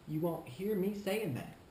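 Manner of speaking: ordinary delivery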